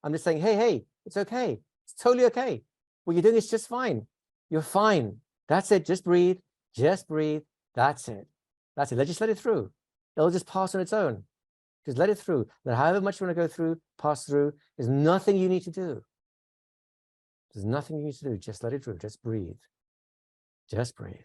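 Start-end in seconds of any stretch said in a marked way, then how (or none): 0.4-16.0 high, insistent reassurance; energized and urgent but in control, easing slightly; louder, demanding. Shown as a demonstration. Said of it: "louder and more demanding voice without actually necessarily becoming worried". 17.5-21.2 soft, lowered, soothing voice; hushed calm coaching with pauses; back down to calm. Shown as a demonstration. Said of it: "go right back down to my calm".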